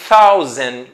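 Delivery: thousand projected, with a clear initial th; "thousand" modelled with English th; TH sound. Shown as a demonstration. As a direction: say "thousand" loudly, leading with th. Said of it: "Observando o som do T H ali".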